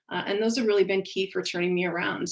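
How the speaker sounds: ordinary talk, nothing marked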